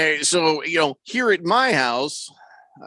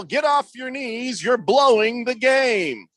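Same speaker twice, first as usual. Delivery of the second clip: yelling at the ump; 7 semitones higher, slower than usual. Retold or imitated. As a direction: yell it at the umpire from the stands.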